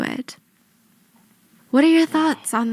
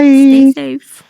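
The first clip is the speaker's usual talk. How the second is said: much louder, called out goodbye; loud sign-off; 12 dB louder than usual. Real, not acted. Host shouting goodbye to viewers, loud. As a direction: belt the farewell.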